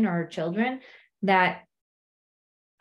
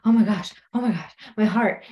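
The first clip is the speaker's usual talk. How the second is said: mock panic; 3 dB louder than usual. Acting racing heart at the snake.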